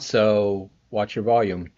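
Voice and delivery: usual speaking voice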